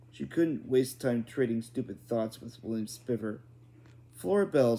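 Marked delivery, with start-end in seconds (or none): 4.1-4.8 loud calling out a name; boy shouting to Flora. A narrator's reading. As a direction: call her name across the yard. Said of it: "said, shouted William Spiver".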